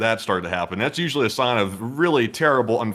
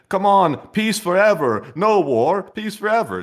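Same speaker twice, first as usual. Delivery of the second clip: high, slow hammed-up plea; mock pleading; 8 semitones higher, 3 dB louder, slower than usual. A dictator begging for peace, high slow mock plea. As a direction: beg for peace like a fake dove.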